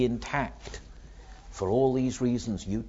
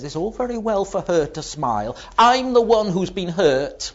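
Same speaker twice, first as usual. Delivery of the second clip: an aggrieved inner voice, rising higher and louder; resentful inner voice; 8 semitones higher, 7 dB louder than usual. Acted. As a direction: let the grudge swell up and out.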